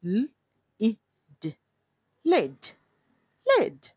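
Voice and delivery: spelled, then "لڈ" with a rising pitch; sounding out letters. Shown as a demonstration. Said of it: "sound ڈال دیتے ہیں".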